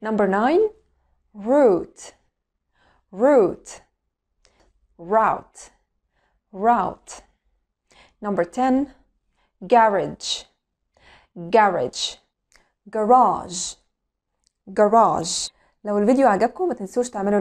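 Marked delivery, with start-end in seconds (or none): 1.3-3.7 British accent demo. Shown as a demonstration. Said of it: "هننطق الأول البريتش أكسنت". 5.0-7.1 American accent demo. Shown as a demonstration. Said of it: "وبعدين الأمريكان أكسنت". 9.7-12.2 British accent demo. Shown as a demonstration. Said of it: "هننطق الأول البريتش أكسنت". 12.9-15.5 garage in American English as "guh-RAHZH", stress on the second syllable; American accent demo. Shown as a demonstration. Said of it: "وبعدين الأمريكان أكسنت".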